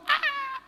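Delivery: a high startled shriek at a mouse; mock shriek. Woman spotting a mouse, high mock shriek. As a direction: squeal like you just saw a mouse.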